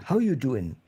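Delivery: amateur New York "How you doing"; attempted New York accent. Shown as a demonstration. Learner trying the phrase, shaky-skilled New York accent. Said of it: "learn how to say a certain phrase".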